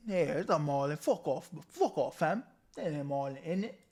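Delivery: imitated London accent. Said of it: "I'm laughing at the accent".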